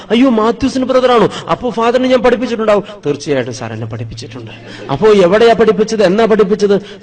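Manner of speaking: put-on old teacher astonished to learn who the priest is; surprised old teacher. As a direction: exclaim in disbelief.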